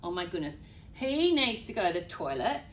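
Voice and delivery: usual speaking voice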